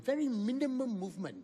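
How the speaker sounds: ordinary talk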